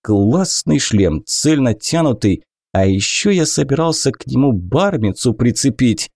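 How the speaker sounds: usual speaking voice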